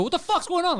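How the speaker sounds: high-pitched sudden challenge; acting out an indignant outburst; retold angry confrontation. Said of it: "Like that".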